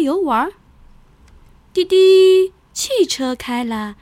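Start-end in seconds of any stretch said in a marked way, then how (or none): 1.7-2.5 car horn beep. Acted. Bus horn in story.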